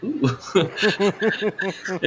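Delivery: high little "ooh" noises; high, laughing mock oohs; imitated Larry's ooh. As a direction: mimic a silly high-pitched ooh. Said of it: "Larry does this thing where he goes".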